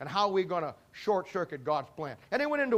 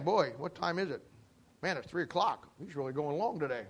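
softer, lower grumbling aside; muttered complaint; 4 semitones lower, 6 dB quieter than usual. Retold or imitated. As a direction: grumble it under your breath. Imitating congregation complaining about length.

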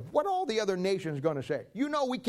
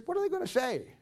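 high mocking quote; 6 semitones higher than usual. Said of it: "He says".

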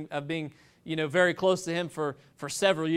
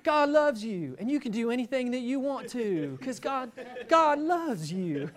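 higher, sugary televangelist croon; mocking feel-good TV preacher, pitched up; Joel Osteen impression; 5 semitones higher than usual. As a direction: sell comfort with a high, sweet preacher lilt. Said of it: "I'll try to blink".

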